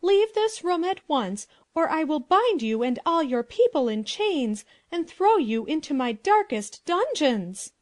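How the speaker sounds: loud, very high outraged princess; angry cry. A narrator's reading. Princess threatens the palace intruders, crying out angrily. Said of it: "and cried out".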